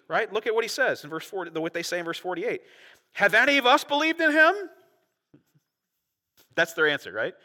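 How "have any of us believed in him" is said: high, loud, incredulous rising question; condescending mock question. Pharisees answering their own officers, condescending. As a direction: climb in pitch as if it's absurd. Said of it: "this condescending attitude".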